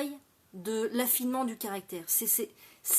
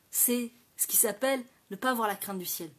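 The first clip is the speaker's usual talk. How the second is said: normal talking voice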